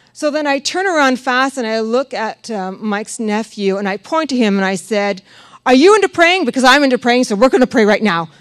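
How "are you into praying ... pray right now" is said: loud, urgent and rushed; urgent call to pray. Retold or imitated. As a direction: push him to pray with you now. Urging nephew to pray.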